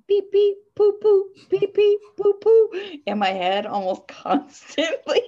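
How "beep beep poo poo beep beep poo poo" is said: high loud beeping chant; toy robot voice. Retold or imitated. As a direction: beep it out like a toy. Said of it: "me saying".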